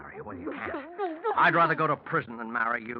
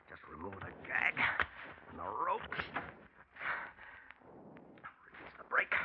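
whispered; 5 semitones lower, 10 dB quieter than usual. Acted. Killer alone staging the crash.

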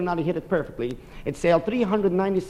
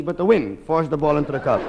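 ordinary talk

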